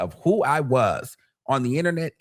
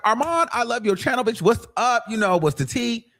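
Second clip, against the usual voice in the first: higher, gushing fan saying hi; excited fan greeting; 7 semitones higher than usual. Retold or imitated. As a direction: greet him like an eager fan. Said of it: "come up to me and be like".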